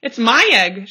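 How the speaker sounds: loud indignant sputter; lazy bird sputtering at Horton, loud. A narrator's reading. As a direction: spit it out in outrage. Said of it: "she sputtered".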